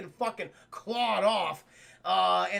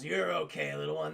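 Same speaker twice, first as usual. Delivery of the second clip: soft low comfort to the cat; gentle reassurance; 6 semitones lower, 4 dB quieter than usual. Acted. Batman comforting the rescued cat, soft low reassurance. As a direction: soothe the kitten. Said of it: "he goes".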